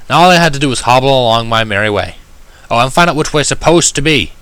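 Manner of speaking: plain reading voice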